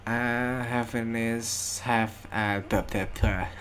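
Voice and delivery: sleepy. Real, not acted. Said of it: "Sumpah gue udah ngantuk banget".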